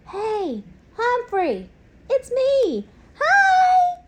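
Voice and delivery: put-on high, loud, rising eager boy's greeting; excited shout. A narrator's reading. Young boy shouts hello to the class hamster. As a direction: call out hi, bursting with excitement. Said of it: "a familiar voice shouted".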